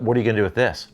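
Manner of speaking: ordinary talk, nothing marked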